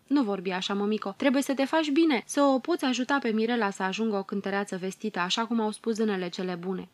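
usual speaking voice

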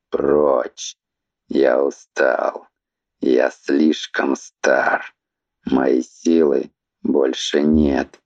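low, slow, creaky drawl; creaky sleepy voice. Acted. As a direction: drag it out old and weary. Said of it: "скрипучим сонным голосом протянув".

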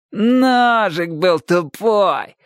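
high, drawn-out singsong mimicry; exaggerated slow, high taunt; mocking mimicry. A narrator's reading. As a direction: parrot his excuse very slowly to tease. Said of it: "передразнил его Шурик".

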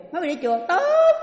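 loud and high, booming praise; hearty approval. Retold or imitated. Husband loudly praises his wife for going to temple. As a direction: burst out in loud delighted approval.

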